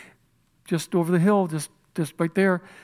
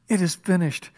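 normal talking voice

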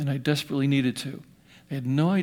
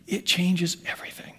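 sudden whispered aside; hushed whisper; 6 dB quieter than usual. Real, not acted. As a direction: drop it to a breathy secret. Preacher drops to hushed aside.

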